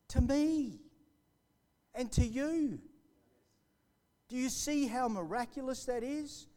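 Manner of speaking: high, loud testimony preaching; fervent preaching. Acted. Testimony preached at full force.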